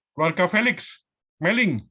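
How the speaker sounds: loud, high roll call; real hail to unseen attendees; calling out names. Real, not acted. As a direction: project each name to someone far away. Calling attendees by name online.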